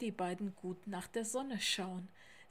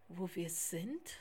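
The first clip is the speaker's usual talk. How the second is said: slow, lower hesitant echo of the question; 4 semitones lower, 4 dB quieter than usual. A narrator's reading. Lars answering Theo's question, hesitantly. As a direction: stall a little, unsure of the answer. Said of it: "sagte Lars zögernd".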